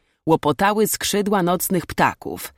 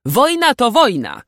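high, loud, unyielding retort; stern companion's forceful reply; hard, firm; 9 semitones higher, 7 dB louder than usual. A narrator's reading. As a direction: repeat it as a hard fact. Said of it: "powtórzył twardo jego towarzysz".

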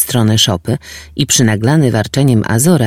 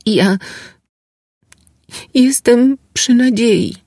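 put-on timid young woman; 12 semitones higher, slower than usual. Girl confesses pregnancy.

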